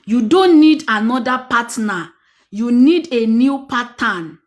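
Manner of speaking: slow and deliberate, for writing down; slow, emphatic dictation pace. Shown as a demonstration. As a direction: spell it out slowly so they can note it. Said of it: "Let me write that down".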